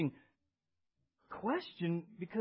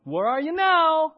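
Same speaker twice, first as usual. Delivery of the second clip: shouted challenge; 10 semitones higher, 12 dB louder than usual. Acted. Preacher acts out demanding where someone is now, shouted challenge. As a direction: call it out at full volume.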